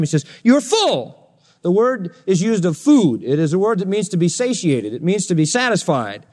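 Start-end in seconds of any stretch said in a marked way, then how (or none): 0.4-1.4 high mock amazement; sarcastic. Paul unmasking the Corinthians' conceit, sarcastic. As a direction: exclaim it high with mock admiration. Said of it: "he starts to use sarcasm".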